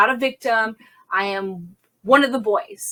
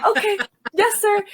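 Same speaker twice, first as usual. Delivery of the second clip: startled compliance; 11 semitones higher than usual. Retold or imitated. Meek reply after being yelled at.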